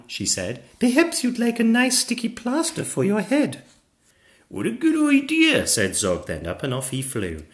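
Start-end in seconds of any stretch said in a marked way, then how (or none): 0.8-3.6 put-on high-pitched helpful child; little girl. Little girl offers help. 4.5-5.7 high, loud keen little dragon; eager young dragon. Acted. Zog accepts eagerly.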